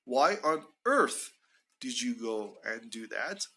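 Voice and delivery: "earth" swung up then down, slowly; rise-fall on earth. Shown as a demonstration. Teacher demonstrating intonation for this expression, rise-fall on "earth". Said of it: "fall intonation for earth".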